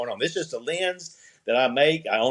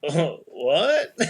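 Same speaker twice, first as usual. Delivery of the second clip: laughing disbelief; 3 semitones higher than usual. Retold or imitated. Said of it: "I was like".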